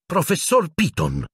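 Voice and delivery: high-pitched scandalised cry; outraged shriek. A narrator's reading. Matron rebuking the professor, shrieking. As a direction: shriek it high without much volume. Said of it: "strillò Madame Pomfrey".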